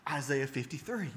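high and loud, piling up the scriptures; rising outrage, list each chapter hard; indignant retold protest. Caller protesting the unread prophecies, loud indignant retelling. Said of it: "I got so mad".

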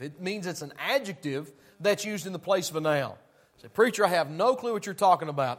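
loud, high-pitched pulpit preaching; fiery preaching. Acted. Preacher to his congregation, fiery full-force preaching.

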